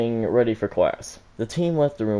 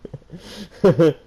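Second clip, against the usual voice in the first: high, loud burst of laughter; 7 semitones higher, 8 dB louder than usual. Real, not acted. Narrator breaks into a laugh.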